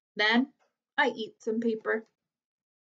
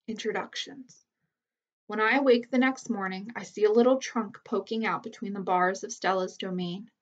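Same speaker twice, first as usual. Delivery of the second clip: ordinary delivery, nothing marked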